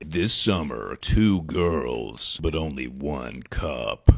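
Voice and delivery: low, slowing trailer voice; movie trailer announcer. Acted. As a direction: intone it like a film trailer.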